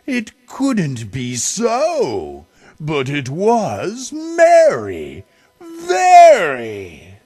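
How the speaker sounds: rising to a loud, high cry on very; amazed. A narrator's reading. Narrator marvels at merry sound.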